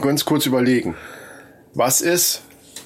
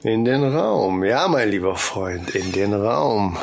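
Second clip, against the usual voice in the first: slow, low creepy killer voice; psychopath killer voice; 8 semitones lower, slower than usual. Pretend. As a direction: drag it out like a calm psychopath. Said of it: "sprich wie ein psychopathischer Killer".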